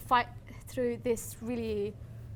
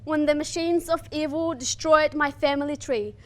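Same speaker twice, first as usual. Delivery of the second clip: poem recitation; 2 semitones higher, 5 dB louder than usual. Acted. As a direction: declaim it like a lament for a lost homeland.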